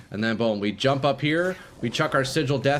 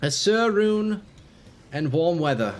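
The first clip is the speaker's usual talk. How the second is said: mock poem recital; 4 semitones higher than usual. Streamer reciting joke rhyme about runes and weather, mock poetic. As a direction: declaim it like grand poetry. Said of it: "Diablo two poetry with that one".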